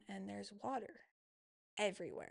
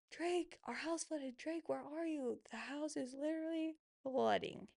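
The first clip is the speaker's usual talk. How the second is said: retold yelling; 6 semitones higher than usual. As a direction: call for "Drake" in a raised, higher voice without real volume. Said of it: "I start yelling for my husband".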